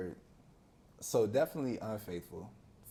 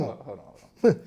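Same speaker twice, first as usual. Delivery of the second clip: louder, startled halt; stunned self in mirror; 10 dB louder than usual. Retold or imitated. Boy at the mirror realizing he's not their son, startled. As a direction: stop short in disbelief. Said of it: "looking in the mirror like".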